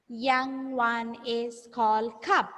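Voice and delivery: very slow teacher modelling; slow repeat-after-me. Shown as a demonstration. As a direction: lead the class word by word. Model sentence for pupils to repeat.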